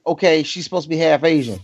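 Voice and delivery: usual speaking voice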